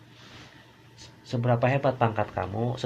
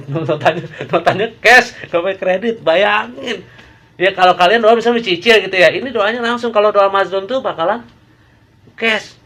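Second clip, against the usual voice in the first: much higher and louder, bursting with excitement; excited loud preaching; 9 semitones higher, 12 dB louder than usual. Real, not acted. Preacher to congregation, prayer answered cash, excited loud burst. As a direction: hammer it home like a fired-up preacher.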